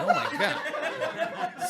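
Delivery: very high, slow "Oh my God"; the crew, freaking out in mock shock; drawn-out mock shock. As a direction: stretch it out in horror.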